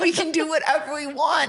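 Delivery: giddy, laughing promise. Real, not acted. Guest teasing the host, laughing. Playful banter, both laughing.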